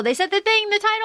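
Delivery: high-pitched, delighted announcement; gleeful excitement. Real, not acted. Host spots the book's title in the story, gleeful. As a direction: lift it high with glee at spotting it.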